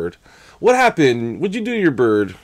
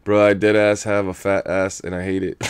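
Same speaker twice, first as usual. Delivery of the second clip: usual speaking voice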